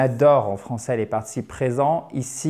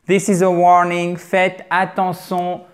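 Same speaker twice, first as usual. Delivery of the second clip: robotic voice; 4 semitones higher, 4 dB louder than usual. Shown as a demonstration. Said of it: "Avec une voix légèrement, euh, robotique".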